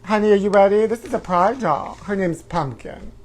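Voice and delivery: ordinary talk, nothing marked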